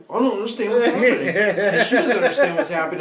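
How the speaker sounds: cracking up at how lost he was; laughing confusion. Retold or imitated.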